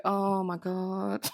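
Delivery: hammed-up sinking realisation; mock dread. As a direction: play at being horrified, stretching each word. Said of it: "if I was Dorothy, I'd be like".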